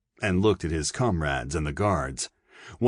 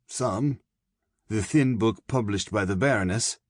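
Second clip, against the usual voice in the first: higher, knowing German colonel, lightly voiced; a sly German officer warning the prisoner; Fritsch, German colonel; 4 semitones higher than usual. A narrator's reading. German colonel warns the young poet of the judge, higher knowing voice.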